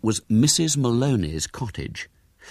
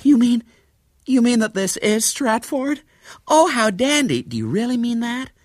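put-on high, gushing young American lady; light up with sudden hope, pitched high and louder; breathless-with-hope delight, voiced high; hopeful excitement; 6 dB louder than usual. A narrator's reading. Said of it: "eyes suddenly alight with hope".

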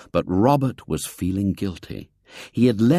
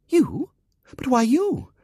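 put-on incredulous girl. A narrator's reading. Girl doubts boy's claim.

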